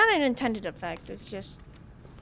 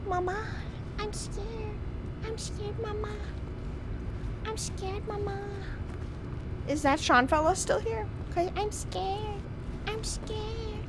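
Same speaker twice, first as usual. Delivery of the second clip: put-on scared child; 7 semitones higher, 5 dB quieter, slower than usual. Playing a frightened child.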